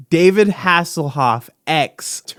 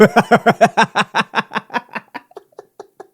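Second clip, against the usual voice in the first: delighted laughter; 4 semitones higher than usual. Real, not acted. Finding Hasselhoff's TikTok.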